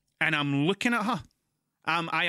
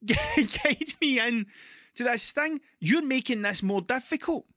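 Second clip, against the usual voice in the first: angry rant; 10 semitones higher than usual. Retold or imitated. To the man guiding him into the space, a high furious rant. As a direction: tell the helpful guy where to go, furious. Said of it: "and I'm like".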